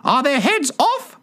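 very high, screeched demand; high-pitched imperious shout; shouted question. A narrator's reading. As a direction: shriek the question like a queen. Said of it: "shouted the Queen".

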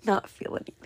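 whispered through a laugh. Real, not acted. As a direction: repeat it in a giggly whisper. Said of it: "That sounds so bad".

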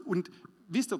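normal talking voice

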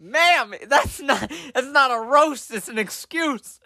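high-pitched comic protest; exasperated, voice pushed up high; mock indignant. Student correcting his teacher about a roast, mock indignant.

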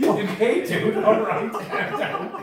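real laughter breaking the words; laughing. Real, not acted. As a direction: talk while cracking up. Reacting through laughter.

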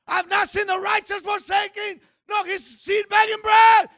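high, loud preacher's shout; booming sermon declaration, pitched high; full-force preaching. Acted. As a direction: proclaim it loudly to the congregation.

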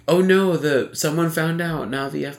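high-pitched hammed-up panic; mock panic. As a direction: play a paranoid teen, hammy. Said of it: "it wasn't like".

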